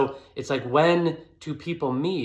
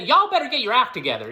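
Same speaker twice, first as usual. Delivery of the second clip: loud, high, fast mock Southern accent; hammed-up Southern scolding; 10 semitones higher, 5 dB louder, much faster than usual. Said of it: "like a Southern hick".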